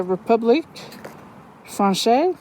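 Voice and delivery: clumsy attempt at a French accent; horrible French accent. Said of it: "That was a horrible accent".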